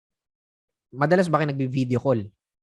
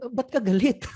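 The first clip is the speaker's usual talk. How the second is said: confused reply; 6 semitones higher than usual. Shown as a demonstration. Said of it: "Baka maging sagot mo sa akin".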